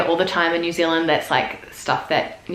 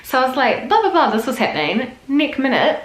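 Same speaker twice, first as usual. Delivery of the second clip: Kiwi storytelling; 4 semitones higher than usual. Retold or imitated. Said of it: "be like".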